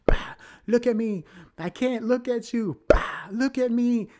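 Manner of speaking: very high, loud, hammed-up wailing; mock-hysterical back-and-forth; frantic slapping scene. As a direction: act out slaps and desperate cries, drawn out. Acting out slapping and desperate replies.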